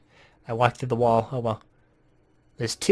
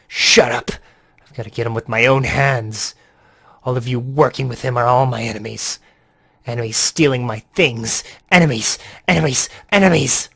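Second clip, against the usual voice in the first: unhinged villain yelling louder and louder; crazed shouting at the traitors; crazy raging voice; 5 dB louder than usual. Acted. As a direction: scream it like he's lost his mind. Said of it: "I'm gonna give him a more, uh, crazy voice".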